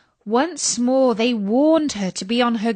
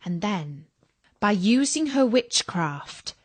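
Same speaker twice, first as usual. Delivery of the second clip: ordinary delivery, nothing marked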